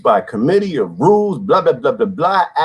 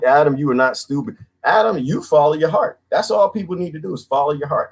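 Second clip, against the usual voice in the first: ordinary talk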